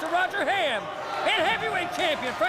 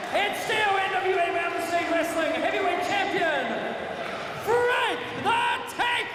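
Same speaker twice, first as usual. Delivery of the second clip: booming pumped announcer. Real, not acted. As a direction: stretch out the champion's name, booming. Ring announcer declares the winner.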